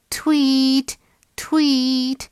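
high, held chirping word; bird tweeting. A narrator's reading. Mother bird heard by the bunny, tweeting. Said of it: "A bird was saying".

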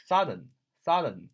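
southern with the first syllable stressed, falling after. Shown as a demonstration. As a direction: hit SOU in southern, let -thern drop. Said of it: "重音在最前面".

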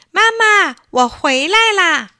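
put-on high, loud boyish call from the doorway; child calling out. Little Edison calling to mom as he comes home, loud and high. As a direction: shout home happily like a little boy. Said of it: "他还没进门就喊".

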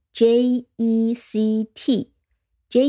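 letters spelled out slowly; slow letter by letter, like dictation; slow spelling. Shown as a demonstration. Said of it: "你可以拼出来了吗". (慢慢逐个字母拼读)

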